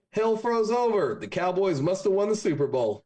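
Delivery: put-on Texas good ol' boy. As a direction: shrug it off like it's a normal day. Joke, punchline.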